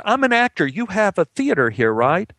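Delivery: high-pitched, eager piecing together; excited realisation. Acted. Actor tells cops his discovery, excited. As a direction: blurt it out as memory returns.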